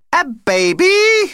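very high, loud, drawn-out squeal; delighted shriek. A narrator's reading. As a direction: shriek it in astonished delight, stretching it out. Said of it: "shrieked Vlad".

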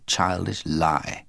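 ordinary delivery

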